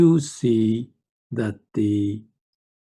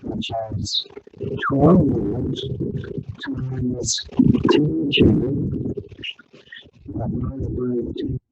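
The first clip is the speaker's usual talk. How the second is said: garbled, broken-up call audio; distorted audio; slower than usual. Real, not acted. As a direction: voice crackling through a bad connection. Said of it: "You sound, um, garbled".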